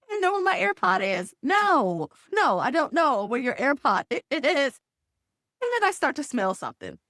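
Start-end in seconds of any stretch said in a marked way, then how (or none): 0.1-1.3 high-pitched imitation of a daughter; daughter's voice. Daughter at the door asking mother, high imitated voice. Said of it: "So she's like". 1.4-4.8 exasperated. Retold or imitated. Mother answers daughter's repeated question.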